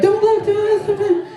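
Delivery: high, squeaky mock pleading girl; nervous girl voice. Comic imitating his nervous partner, high pleading squeak. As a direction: squeal it like a shy girl begging. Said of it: "She's like".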